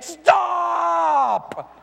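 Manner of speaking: long, high shouted warning; acted desperate shout, high and drawn out; parent's yell. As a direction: yell it at a child near a cliff edge, stretching the vowel. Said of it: "You would yell at your kid".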